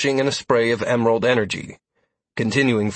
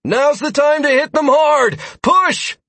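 rallying shout; 6 dB louder, slower than usual. A narrator's reading. Sierra rallying fighters.

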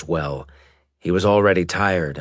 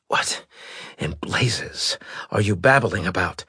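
unvoiced furious whisper; angry whisper. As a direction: demand it in a rasping whisper. Weak admiral demands answer.

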